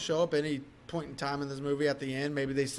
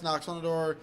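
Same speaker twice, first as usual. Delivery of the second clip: ordinary talk, nothing marked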